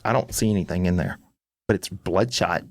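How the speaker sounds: understated, dismissive wife; imitated unimpressed wife. Wife finding nothing in husband's eye, understated dismissal. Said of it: "And she's just like".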